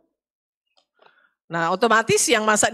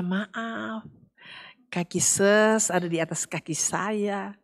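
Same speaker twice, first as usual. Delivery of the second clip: soft, slow, very polite; politely soft voice; gentle polite apology; 6 dB quieter, slower than usual. Retold or imitated. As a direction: apologize meekly and softly. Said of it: "yang lain bicaranya lembut".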